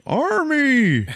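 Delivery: high, loud answering call; hammed-up holler to an old pal; delighted-recognition shout. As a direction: imitate calling back a name in amazement. Sniper answers, recognizing his friend.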